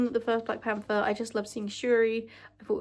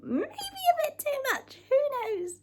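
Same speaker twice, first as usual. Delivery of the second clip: much higher, slower coy sing-song; slower than usual. Real, not acted. Vlogger admitting her Wanda crush, coy and high. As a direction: tease it out playfully. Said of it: "admitting Wanda obsession".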